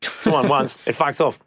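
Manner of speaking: breezy Australian, no worries; an Australian shrugs off spider fears in a laid-back Australian accent; Australian bloke. Retold or imitated. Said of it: "They'll be like".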